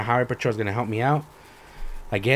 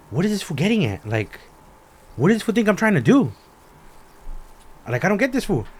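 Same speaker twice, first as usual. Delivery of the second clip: high-pitched incredulous questioning; baffled exasperation; 7 semitones higher than usual. Retold or imitated. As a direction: wonder aloud what he could possibly want. Retells bafflement at the man.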